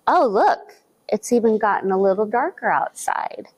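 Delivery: ordinary delivery, nothing marked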